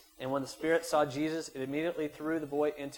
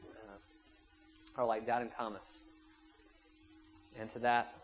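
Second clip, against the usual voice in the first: normal talking voice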